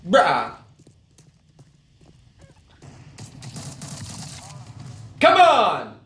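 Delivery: high furious yelling; raging gamer. Real, not acted. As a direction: scream at the other players. Raging at the game.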